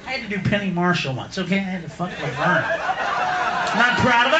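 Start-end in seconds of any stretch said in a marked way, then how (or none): 2.7-4.4 drawn-out admission; defensive confession. Acted. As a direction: slowly own up to it. Mock-defensive confession, repeated louder.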